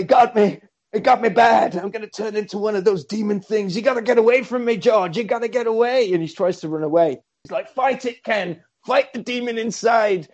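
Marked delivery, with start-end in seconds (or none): 0.0-6.1 higher-pitched hammed-up warning; mock urgent panic. Acts infected Ken warning George. 7.7-10.3 high, hammed-up exhortation; mock urgent plea. Acts George urging Ken on.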